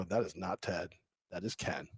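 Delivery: low, hushed retold aside; very soft, low, deliberate correction; quiet realization. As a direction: say it softly, as if to yourself. Retelling own realization while listening.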